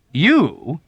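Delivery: harsh. A narrator's reading. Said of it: "with asperity".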